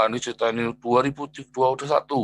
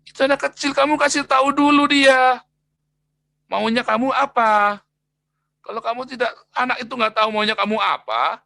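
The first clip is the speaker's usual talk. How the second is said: firm counsel, pitched higher and louder; preacher replaying his plea to a parent, higher and louder; retold urgent advice; 8 semitones higher, 4 dB louder than usual. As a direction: urge the harsh father to stop and explain. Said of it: "Terus saya bilang".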